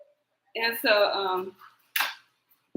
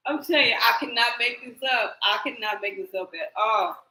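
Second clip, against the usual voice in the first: ordinary talk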